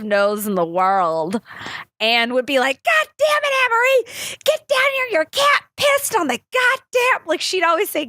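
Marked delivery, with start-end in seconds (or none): 2.8-7.3 much higher, a mock angry holler; imitating mom yelling. Mom yelling up for her daughter about the cat, mock angry. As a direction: belt it up the stairs like a fed-up mom.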